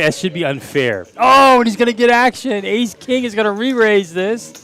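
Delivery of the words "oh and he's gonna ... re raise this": higher, louder play-by-play burst; excited call. Real, not acted. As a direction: sell the big moment. Big hand gets action.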